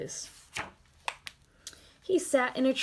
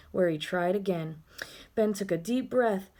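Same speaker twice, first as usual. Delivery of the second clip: usual speaking voice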